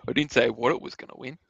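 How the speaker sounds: ordinary talk, nothing marked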